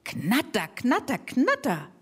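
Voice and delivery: chopped "Knatter" like a rattling engine; imitating a clattering lawnmower; mower rattle imitation. Shown as a demonstration. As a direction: rattle the words out like a motor.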